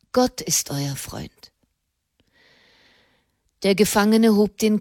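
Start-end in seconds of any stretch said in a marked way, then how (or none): 0.1-1.5 solemn reassurance. A narrator's reading. Nun telling prisoner God is his friend, hushed solemn reassurance. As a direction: offer it softly as comfort. Nun consoles condemned man.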